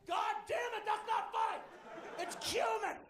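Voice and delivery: high-pitched imitated shouting, hammed up; mock yell. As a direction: roommate yelling at his girlfriend. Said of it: "And he's yelling".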